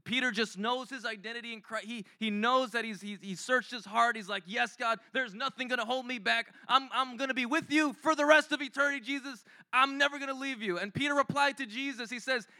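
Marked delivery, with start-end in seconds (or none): 4.4-10.8 a bit higher and louder, eager and earnest; eager vow. Retold or imitated. Peter pledging to Jesus, eager and proud. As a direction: swear loyalty like a keen disciple.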